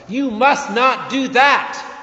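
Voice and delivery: loud, high shout of warning; stern rebuke. A narrator's reading. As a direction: stop him with a sharp shout. Angel rebukes John.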